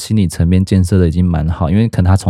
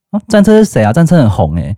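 clueless teacher; 6 semitones higher than usual. Retold or imitated. The teacher voiced in the story.

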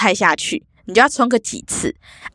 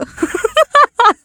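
laughter; 4 dB louder than usual. Real, not acted. 讲到马桶笑出来.

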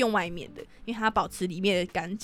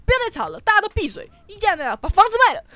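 high loud furious shout; furious mom; 10 semitones higher, 5 dB louder than usual. Retold or imitated. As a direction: explode and silence everyone. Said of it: "然后我妈就暴怒". (暴怒大喊叫大家闭嘴)

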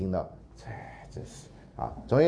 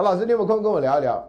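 pleading student; 9 semitones higher, 6 dB louder, faster than usual. Retold or imitated.

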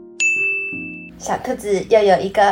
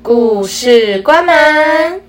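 story closing call; 6 semitones higher, 9 dB louder, much slower than usual. Acted.

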